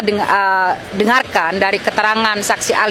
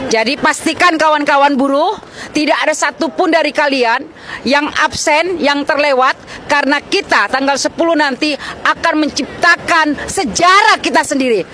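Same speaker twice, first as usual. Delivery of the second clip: fiery rally speech; 4 semitones higher than usual. Real, not acted. Rallying workers to protest.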